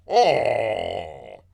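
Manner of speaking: long, loud drawn-out aww; hammed-up groan of pity; sympathetic "aww". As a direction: let out a big, falling aww. Mock pity at the breakup story.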